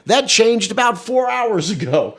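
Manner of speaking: mock Trump boasting about Russia; hammed-up presidential bluster; Trump impression. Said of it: "but then he added".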